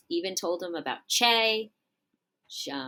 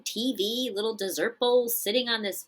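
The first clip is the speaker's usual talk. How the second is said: higher-pitched retold wife's complaint; mock exasperation; 6 semitones higher than usual. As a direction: act out a fed-up wife listing her grievances. Acted wife's outburst in marital quarrel.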